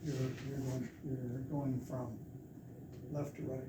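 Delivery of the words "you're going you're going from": ordinary talk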